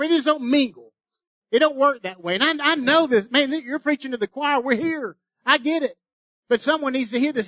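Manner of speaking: high, loud, driving preacher's cry; preaching at full force. Acted. Pastor urging the church to commit, preaching at full force. Pastor voicing Joshua, building.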